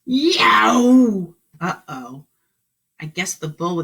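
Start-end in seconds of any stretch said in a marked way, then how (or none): 0.0-1.3 loud yelping cry; startled yelp. Acted. Duncan yelping at the charging bull.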